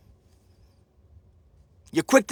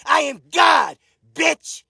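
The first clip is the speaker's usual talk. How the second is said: repeated shouted boast; 5 semitones higher, slower than usual. Real, not acted. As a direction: hammer the boast home like a chant. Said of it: "Let me say it one more time".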